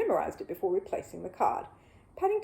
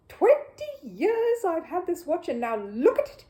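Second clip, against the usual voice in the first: high, slow indignant outburst; angry complaint; 9 semitones higher, 5 dB louder, slower than usual. Acted. Mister Curry holding up his smashed watch, indignant. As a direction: wail over the ruined watch.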